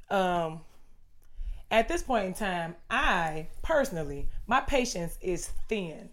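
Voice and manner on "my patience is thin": ordinary talk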